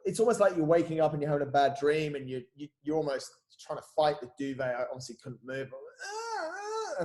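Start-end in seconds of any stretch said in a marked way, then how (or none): 6.1-7.0 high, quiet pathetic moan; pathetic groan. Retold or imitated. Imitating himself waking in ICU unable to move, pathetic groan. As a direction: imitate a feeble, helpless groan. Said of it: "like, pathetically".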